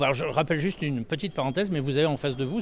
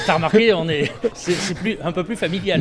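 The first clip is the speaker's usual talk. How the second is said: laughing; 4 semitones higher, 5 dB louder than usual. Real, not acted. Host laughs while joking.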